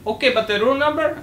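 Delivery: imitating clerk. Retelling the pizza call, mocking the clerk's question. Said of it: "he's like".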